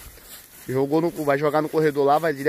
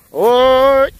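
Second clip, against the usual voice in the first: high, loud herding yells; loud calls to push the herd along; cattle calls; 11 semitones higher, 12 dB louder than usual. Real, not acted. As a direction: holler to drive the cows on. Calling the herd.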